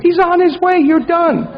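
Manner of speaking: high, emphatic and excited; high, eager assurance; with passion. Shown as a demonstration. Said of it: "like with passion".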